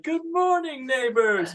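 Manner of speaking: high falsetto morning greeting; imitating the prince voice. Host replaying his old TikTok greeting to neighbors. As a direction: call out grandly to the neighbors. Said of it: "It's like".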